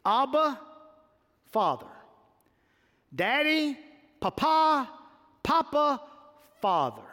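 put-on tender child's cry; a child crying to a father. Shown as a demonstration. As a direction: cry it out like a child to his dad. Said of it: "watch this".

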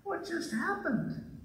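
high, slow, dazed disbelief; a stunned disciple asking, high and slow; gaping at what they just saw. Retold or imitated. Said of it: "I imagine they're like".

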